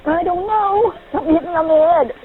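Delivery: high, fast panicked voice; real fear, words rushing out; panicking. Real, not acted. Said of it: "I know I shouldn't be panicking".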